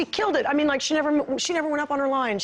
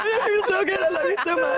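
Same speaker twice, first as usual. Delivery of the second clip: high, over-the-top doting mom; mock gushing; 8 semitones higher than usual. Mom retelling how she adored her daughter on set, gushing. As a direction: gush in a hammed-up high voice.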